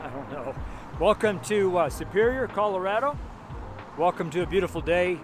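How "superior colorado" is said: ordinary delivery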